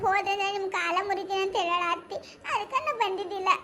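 put-on a small girl telling tales on her aunt; little girl. Child tells granny about aunt. (ಅಳುನಗುವಿನ ಪುಟ್ಟ ಹುಡುಗಿಯ ಧ್ವನಿ)

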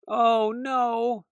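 high mock cry of dismay. Mouse to Nurse Weasel, dreading carrots, a high mock cry. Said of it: "cried Mouse".

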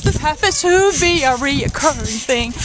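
usual speaking voice

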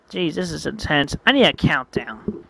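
ordinary delivery, nothing marked

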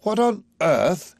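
high, slow, stretched-out disbelief; astounded. A narrator's reading. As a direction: draw it out in astonishment. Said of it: "Higgins is astounded".

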